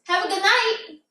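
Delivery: polite brush-off. Retold or imitated. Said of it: "I was like".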